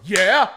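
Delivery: mock yelp. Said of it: "I was like".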